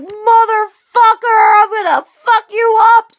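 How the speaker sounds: high, loud raging scream; a woman losing it at her partner; hammed-up furious threat; furious yell.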